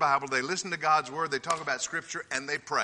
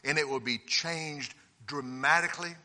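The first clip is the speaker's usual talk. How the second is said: ordinary delivery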